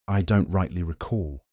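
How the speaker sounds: ordinary delivery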